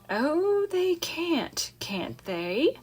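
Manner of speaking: rising then dropping to a whisper; a soft murmur fading into a whisper; murmured to herself. A narrator's reading. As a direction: Charlotte muttering privately. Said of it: "murmured Charlotte to herself".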